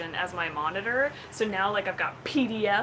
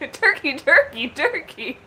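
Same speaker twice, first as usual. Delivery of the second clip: loud calling; 7 semitones higher, 10 dB louder than usual. Real, not acted. Calling out game words.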